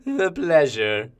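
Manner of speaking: slow, lingering relish; savouring pleasure. Acted. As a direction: draw it out with relish. Savours the victim's death.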